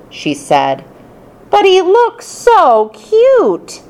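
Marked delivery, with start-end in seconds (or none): 1.5-3.8 put-on high, slow cooing girl voice; called out. Heidi calling out to the teacher, high slow coo. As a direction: coo it like a smitten kid. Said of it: "Heidi called out".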